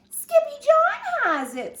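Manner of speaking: high, loud little-sister voice; tattling kitten sisters. A narrator's reading. Sisters tell mama who took the ladder, high-pitched. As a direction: pipe up high to tell on him. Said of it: "said the sisters".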